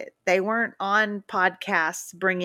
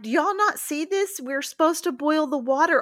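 freaking out; 6 semitones higher than usual. Retold or imitated. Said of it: "I'm freaking out".

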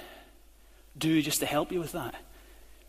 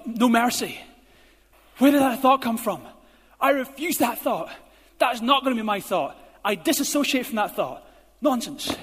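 loud, firm refusal of thoughts; forceful rejection; 5 semitones higher, 5 dB louder than usual. Pretend. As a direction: shove each thought away hard.

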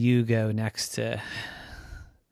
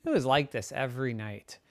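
usual speaking voice

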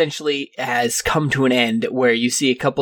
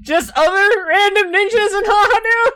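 very high, hammed-up indignant ninja; squeaky ninja voice; 5 dB louder than usual. Game master voicing a ninja.

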